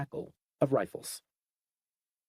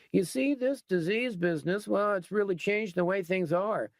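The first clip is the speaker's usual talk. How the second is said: higher, reasonable-sounding gang leader, lightly voiced; folksy biker captain; 5 semitones higher than usual. A narrator's reading. Gang captain explains the new order to the captive, lightly voiced. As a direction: talk it through like a calm, self-satisfied boss.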